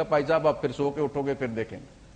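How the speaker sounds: mock sleepy phone assistant, dropping soft and low at the end; lightly voiced drowsy reply fading to a mumble; groggy just-woken phone voice. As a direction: play the phone brushing off a sleepy caller. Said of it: "اس طرح کی آواز بناؤ".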